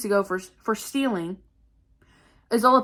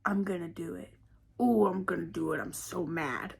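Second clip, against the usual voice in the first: low, hushed threat, imitated; imitated creepy muttering, very soft; menacing in the ear; 5 semitones lower, 9 dB quieter than usual. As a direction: whisper it like a creepy stalker behind someone. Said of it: "she's all up in my ear".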